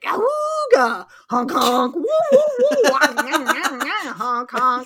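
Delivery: goofy horn honks and hoots; silly clown. Retold or imitated. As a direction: honk like a cartoon clown horn. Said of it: "he's just like".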